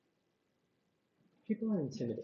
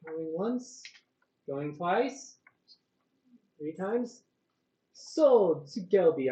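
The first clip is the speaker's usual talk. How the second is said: auctioneer; 6 semitones higher, 5 dB louder than usual. Acted. DM runs the auction.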